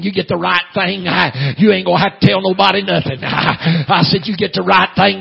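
building from loud cadence to high shouting; full-force preaching. Real, not acted. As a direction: whoop it out with a gasped "hah" after phrases.

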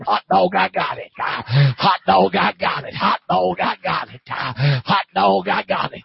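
high, loud revival holler; preacher's jubilant repeated shout. Retold or imitated. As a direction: holler it rhythmically like a rejoicing convert.

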